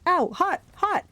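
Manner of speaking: high, short yelps; pained yell. A narrator's reading. Grover holding the hot whip, pained yelping. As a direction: yelp as if fingers are burning. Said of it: "he yelled".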